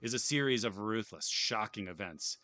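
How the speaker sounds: announcer-style reading of the game's intro; dramatic read-aloud. Acted. Streamer reading the intro text to viewers, mock announcer. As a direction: boom it out like a trailer voiceover.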